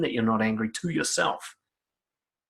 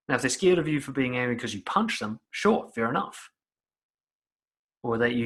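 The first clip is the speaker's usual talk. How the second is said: ordinary talk, nothing marked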